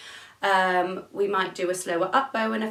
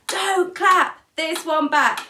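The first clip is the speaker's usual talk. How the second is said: slow, higher, louder call-out; shouted call; 7 semitones higher, 5 dB louder, much slower than usual. Shown as a demonstration. Teacher shows pupils the phrase to shout at her, slow and loud. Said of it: "you can shout at me".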